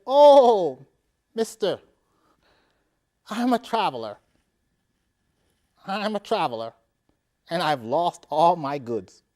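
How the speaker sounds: high, loud pleading stranger; pleading traveler. Acted. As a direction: beg the rich man for help. Angel disguised as leper pleading.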